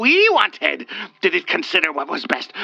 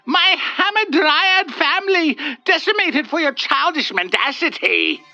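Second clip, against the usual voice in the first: squealing in anguish; 11 semitones higher than usual. Acted. The wraith squealing in anguish at Olga. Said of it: "it squealed in anguish and fury".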